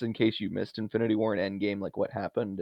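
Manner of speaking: normal talking voice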